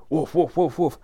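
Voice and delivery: high-pitched mock woofs; quick string of pretend woofs; imitated dog barking. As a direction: bark like a dog greeting its owner. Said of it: "instead of you coming home and going".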